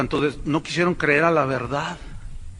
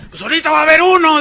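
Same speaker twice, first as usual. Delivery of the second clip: higher, louder mock televangelist, rushing; showy preacher; 10 semitones higher, 8 dB louder, much faster than usual. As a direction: ham up the stage preacher's promise. Voicing the showman preacher. (predicador de show exagerado, agudo y rápido)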